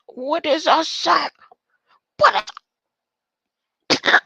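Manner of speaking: high, loud confused cry; baffled. Real, not acted. Reading a strange term, baffled high and loud. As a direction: stumble on the word, pitch shooting up.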